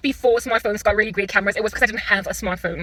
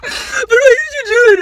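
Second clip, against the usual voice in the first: mock tearful whine; 10 semitones higher, 5 dB louder than usual. Imitating a crying driver who lost a spot, mock sobbing. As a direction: blubber like a sore loser. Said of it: "while you sit there on some".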